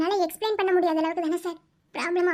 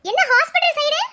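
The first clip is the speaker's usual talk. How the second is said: loud, higher-pitched surprised questioning; 3 semitones higher, 5 dB louder than usual. Acted. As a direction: call out to a friend you didn't expect. Runs into friend at hospital, surprised. (ஆச்சரியமாக, சத்தமாக)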